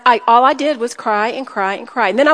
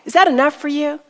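usual speaking voice

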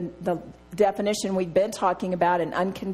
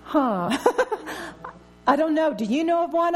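laughing retold reply; 6 semitones higher than usual. Preacher retelling her counselor session, laughing. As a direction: laugh through the embarrassed answer. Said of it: "I said".

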